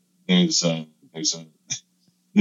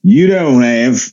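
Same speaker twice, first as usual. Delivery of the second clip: deliberate mock Aussie with long pauses; Australian accent. Australian passenger judging the comedian's act, mock Australian accent. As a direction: deliver a blunt Aussie verdict. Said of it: "I apologize to my Australian listeners".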